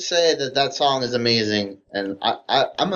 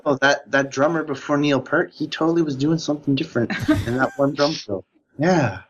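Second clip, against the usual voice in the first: higher-pitched music-nerd voice; 5 semitones higher than usual. Acted. Speaker mimes a chin-stroking Rush fan, mock nerd voice. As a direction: play a pedantic superfan musing into the mic. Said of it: "super hardcore Rush fan's doing".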